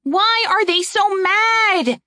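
high, slow shout; shouted question. Acted. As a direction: shout it over the chaos. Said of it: "Erin shouted it at Saria".

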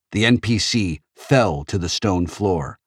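usual speaking voice

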